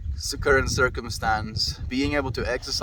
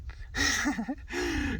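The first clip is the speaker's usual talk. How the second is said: high giggle; 12 semitones higher, 5 dB quieter than usual. Real, not acted. Laughs at her dog.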